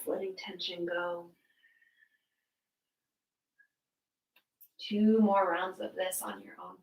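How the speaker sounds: soft, spaced-out instructions with long pauses; unhurried, hushed yoga teacher; yoga guidance. Real, not acted. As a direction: guide the breathing quietly, leaving room to move. Leads breath-matched yoga flow.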